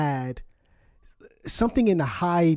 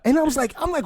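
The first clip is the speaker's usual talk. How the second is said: ordinary delivery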